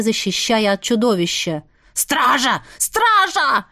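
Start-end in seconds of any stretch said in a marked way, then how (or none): 1.9-2.6 first call for guards. A narrator's reading. Baron calls the guards, first moderate shout. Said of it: "заорал барон". 2.8-3.7 now really bellow for the guards; shouting for guards. A narrator's reading. Baron yells for the guards again, much higher. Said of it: "заорал барон".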